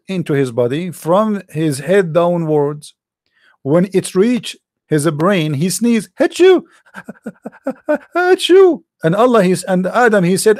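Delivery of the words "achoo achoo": high theatrical achoo sneezes with a laugh; mock sneeze. Acting out Adam sneezing, a high hammed-up achoo.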